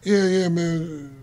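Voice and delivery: slow, low, quiet brush-off; Puff. Retold or imitated. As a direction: imitate an unimpressed, drawn-out reply. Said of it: "and Puff was like".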